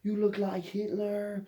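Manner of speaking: high, drawn-out mock astonishment; exaggerated high voice, stretching the words; [name]. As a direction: imitate a German guy's amazed outburst. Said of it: "And he's like".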